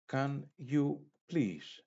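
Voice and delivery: ordinary talk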